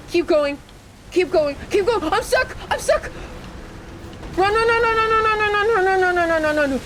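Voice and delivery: high, panicked, rising urgency; frantic squeals while fleeing; being chased. Real, not acted. Fleeing enemies in game.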